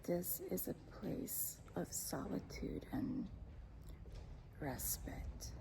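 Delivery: soft, low unvoiced whisper at a quiet church; whispered reverence in a quiet churchyard. Real, not acted. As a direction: hush your voice like in a sacred place. Hushed at a place of solitude.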